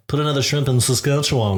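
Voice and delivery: laughing mock-Australian catchphrase; badly faked Australian accent; attempted Australian accent. Shown as a demonstration. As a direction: try Australian, amused at yourself. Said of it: "Then I'm like".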